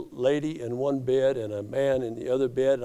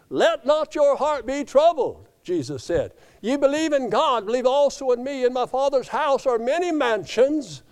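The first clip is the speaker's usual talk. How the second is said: preaching at full force; 8 semitones higher, 3 dB louder than usual. Acted. Preacher quotes Jesus, raised.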